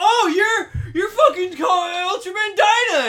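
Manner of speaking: loud, high fanboy gushing; mock starstruck excitement. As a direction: gush loudly as if meeting your idol. Acts out starstruck hero meeting idol.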